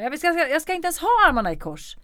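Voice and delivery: high, loud, fed-up protest; exasperated outburst. Retold or imitated. Retold outburst at film crew.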